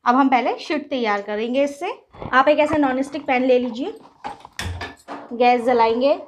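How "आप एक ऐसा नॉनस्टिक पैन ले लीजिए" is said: ordinary talk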